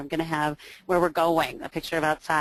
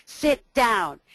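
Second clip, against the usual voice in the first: barked order, louder and higher; loud strong command; 6 semitones higher, 4 dB louder than usual. Retold or imitated. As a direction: mimic the mother's firm command. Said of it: "in a really loud, strong voice".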